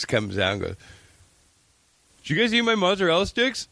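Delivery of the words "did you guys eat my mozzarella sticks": imitating accusing teen. Brother accuses, retold quote.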